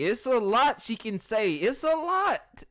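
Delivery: high, slow exasperated whine. Real, not acted. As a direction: stretch it out in disbelief. Exasperated emphasis.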